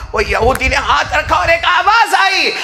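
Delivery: high, loud, impassioned storytelling; a preacher shouting the tale to the crowd; full-force preaching. Acted. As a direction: preach the story out loud, driving each line.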